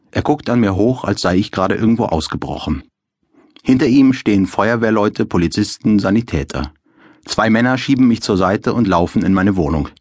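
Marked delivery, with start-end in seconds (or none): none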